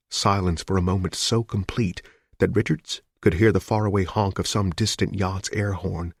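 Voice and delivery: ordinary talk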